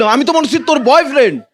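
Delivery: ordinary delivery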